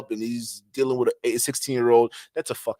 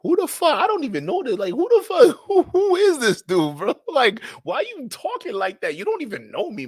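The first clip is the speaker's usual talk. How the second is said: very high-pitched, louder mock outrage; incredulous squeal; 13 semitones higher, 4 dB louder than usual. Streamer replaying his shock at an oversharer, squeaky disbelief.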